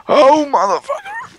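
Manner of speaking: high-pitched startled curse; alarmed cursing. Real, not acted. Player cursing at sudden trouble, alarmed high yelp.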